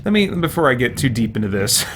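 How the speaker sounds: ordinary talk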